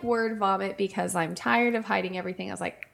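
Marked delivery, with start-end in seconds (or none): none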